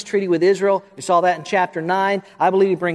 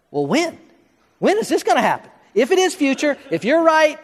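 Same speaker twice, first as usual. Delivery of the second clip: high-pitched, challenging doubter; voiced objector, pitched well up; skeptical questioner; 5 semitones higher than usual. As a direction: press the question like an incredulous skeptic. Voicing a skeptic's challenge to himself.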